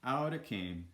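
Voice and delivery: ordinary delivery, nothing marked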